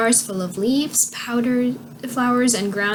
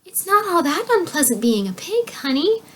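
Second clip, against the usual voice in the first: lightly voiced mother, pitched higher; a hint of a lady soothing her child, higher; Melisande; 8 semitones higher than usual. A narrator's reading. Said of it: "said Melisande".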